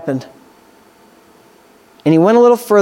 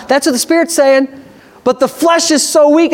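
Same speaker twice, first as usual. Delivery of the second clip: ordinary delivery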